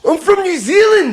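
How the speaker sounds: very high, indignant Kiwi protest; exaggerated offence; mock offended Kiwi. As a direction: protest it in a hammed-up, high-pitched voice. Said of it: "if you are a Kiwi".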